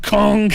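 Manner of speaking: loud imitated stamp thud; angry passport stamp. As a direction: bang out the noise like an angry stamp. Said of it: "Just angrily".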